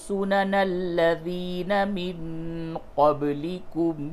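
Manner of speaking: slow, high chanted tajweed recitation; Quran recitation. Shown as a demonstration. Teacher chanting the verse for students, slow and melodic. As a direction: intone the verse slowly and melodically. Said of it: "پھر سے اس جگہ سے سماعت فرمائیے".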